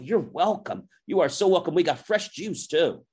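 high, over-eager host; gushing welcome. Retold or imitated. Retold vendor gushing over a thank-you.

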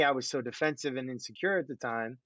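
ordinary talk, nothing marked

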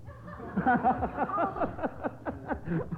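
a real laugh at himself; sheepish chuckle from the host; amused laugh. Real, not acted. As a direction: laugh it off, tickled. Host laughs at his own card.

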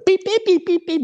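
high-pitched imitated electronic beeps; quick squeaky mimicked keypad tones. Imitating the neighbor punching in the code, beeping.

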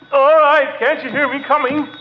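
grumpy shout at the phone; irritated yell. Acted. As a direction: yell that you're coming. Woken man yelling at phone.

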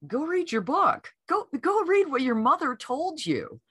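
high, mock stern self-reprimand; lightly voiced nagging; scolding herself. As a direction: tell yourself off like a parent. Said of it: "And then I'm like".

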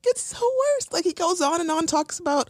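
rising outrage. Real, not acted. Building disgust retelling.

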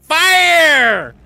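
very high, long battle cry; gnome shouting. Acted. Gnome ordering the cannons fired, high drawn-out shout. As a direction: yell it out like a gnome gunner. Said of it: "And then gnome goes".

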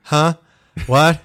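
loud, puzzled double take; acted confusion, punched loud; baffled. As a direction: blurt it out, completely thrown. Baffled reaction to odd questions.

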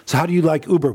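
high, loud, breezy question mimicking curious passengers; mock customer question. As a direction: toss it off like a cheery passenger. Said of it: "We get this question a lot from people".

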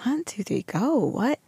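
baffled mom. Retold or imitated.

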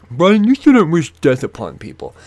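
high, loud, put-on scold; mock a prissy listener scolding Brian; scolding critic voice. Imagined listener scolding Brian, high mocking voice.

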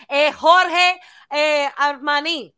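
put-on high, loud Spanish-accented mom; Dominican mom accent. Imitating Dominican mom saying Armani, high accented. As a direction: mangle the name like mom.

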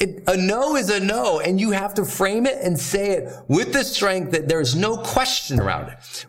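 ordinary talk, nothing marked

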